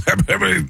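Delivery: spluttered wordless rev-up; stammering sportscaster mumble; John Madden. Shown as a demonstration. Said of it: "that's Madden".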